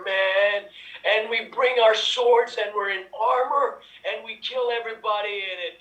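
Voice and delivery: ordinary talk, nothing marked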